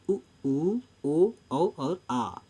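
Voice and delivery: उ, ऊ, ओ, औ, आ sounded slowly with rounded lips; drawn-out vowel demo. Shown as a demonstration. Teacher showing students rounded vowels उ, ऊ, ओ, औ, आ, slowly drawn out. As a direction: stretch each rounded vowel उ, ऊ, ओ, औ, आ. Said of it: "बोल के देखिए".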